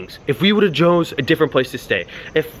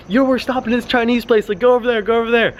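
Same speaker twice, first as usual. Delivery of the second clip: mock yell; 7 semitones higher than usual. Directing Groms to the Chinese place, high mock yell. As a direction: re-enact hollering directions to the riders. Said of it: "this kid's yelling out the window".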